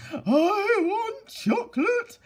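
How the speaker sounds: high, very loud, slow, hammed-up bawl; bawling girl. The girl in a tantrum bawls for chocolate, hammed-up shrieking demand. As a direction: howl it like a spoilt child in a tantrum. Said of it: "bawled the girl".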